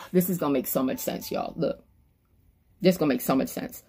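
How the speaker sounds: low, quick and soft; hushed aside. Real, not acted. Preacher promising listeners it will make sense, hushed quick aside. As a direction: drop right down as if confiding.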